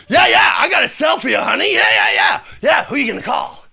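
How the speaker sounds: loud, fast jeering taunt; loud mocking taunt; mock taunting shout. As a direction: bark it like a sneering cop. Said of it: "He's suddenly like".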